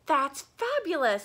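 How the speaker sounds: loud delighted hippo. Acted. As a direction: gush over the new color. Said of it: "said Hippo".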